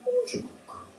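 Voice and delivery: high, soft pet talk. Real, not acted. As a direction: coo at the puppy. High baby talk to dog.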